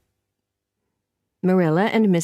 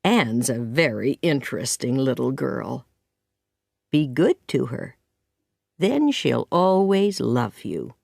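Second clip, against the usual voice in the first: lightly voiced kindly man, lower at first; gentle Matthew; 5 semitones lower than usual. Narrator voices Matthew in the story.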